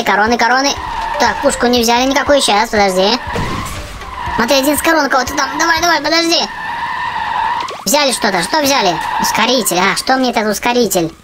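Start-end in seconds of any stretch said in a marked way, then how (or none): none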